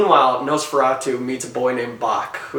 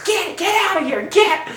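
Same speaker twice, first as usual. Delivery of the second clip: high shouted shoo like to a dog. Retold or imitated. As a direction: chase the animal off. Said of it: "She goes".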